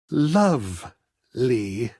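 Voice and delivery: a slow, savoring repeat of Lovely; dreamy echo. Acted. Artist savoring time spent talking, slow dreamy echo. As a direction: let Lovely melt out slowly, wistful.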